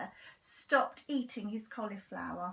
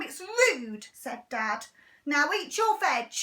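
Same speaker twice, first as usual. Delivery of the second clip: loud, higher scolding; stern; 4 semitones higher, 7 dB louder than usual. Acted. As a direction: tell them off firmly. Mum scolding sons.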